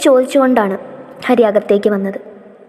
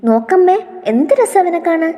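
delighted; 7 semitones higher than usual. Acted. Girl delighted by the newborn.

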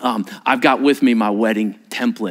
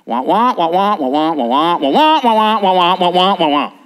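louder mock adult-speech drone; droning wah wah; 5 semitones higher, 5 dB louder than usual. Pastor mocking how guests hear the liturgy as wah wah. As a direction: imitate muffled grown-up talk as wah wah. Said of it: "what everyone hears".

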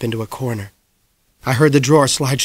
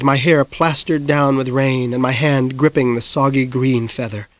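ordinary delivery

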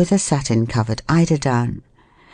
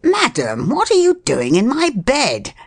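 indignant, swooping question; 10 semitones higher than usual. A narrator's reading. Man to the woman in his bed, indignant. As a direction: demand it with offended disbelief. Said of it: "said the voice indignantly".

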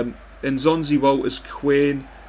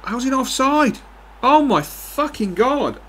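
much higher, loud outraged cry; furious disbelief; 12 semitones higher, 3 dB louder than usual. Real, not acted. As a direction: yell at the screen over the call. Furious at an offside call.

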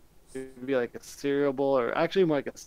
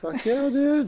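high loud cheer; excited; 13 semitones higher, 6 dB louder, much slower than usual. Real, not acted. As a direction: hype it up. Enthusiastic agreement.

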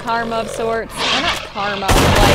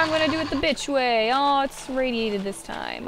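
usual speaking voice

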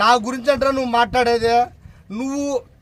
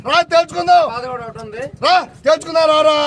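angry challenge; 3 semitones higher than usual. Real, not acted. Leader daring his rival to come and settle it, angry shout. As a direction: bark the challenge twice.

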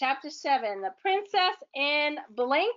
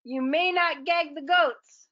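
raised, stern command about goats; shouted; 5 semitones higher, 4 dB louder than usual. Acted. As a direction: scold the monster with a shout. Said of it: "shouted Miss Fix-It".

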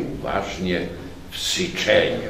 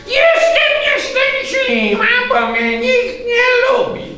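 high loud wail, slower and clearer; repeating octopus; 8 dB louder than usual. A narrator's reading. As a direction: say it a third time more clearly. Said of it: "trzy razy powtórzyć".

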